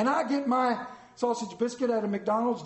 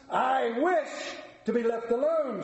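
Scrooge; 5 semitones higher, slower than usual. Acted. Scrooge rebuffs the gentlemen.

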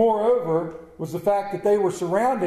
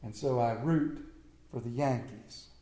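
quiet; 7 semitones lower, 7 dB quieter than usual. Acted. Punchline delivered softly.

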